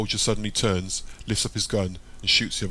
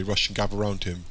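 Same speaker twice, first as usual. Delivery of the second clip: plain reading voice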